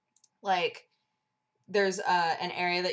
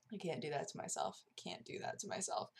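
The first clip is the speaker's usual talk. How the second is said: very soft, dropping to a whisper; hushed self-talk; 4 semitones lower, 12 dB quieter than usual. Retold or imitated. Talking herself out of re-entering the haunt, hushed. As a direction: say it under your breath to yourself.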